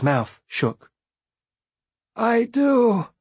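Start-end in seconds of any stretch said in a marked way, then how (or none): 2.2-3.1 high, drawn-out, moved reply; emotional answer. A narrator's reading. Beloved remembers Bee's apple as tears well, deeply moved.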